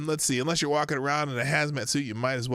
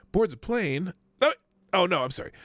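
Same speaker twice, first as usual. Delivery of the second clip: ordinary delivery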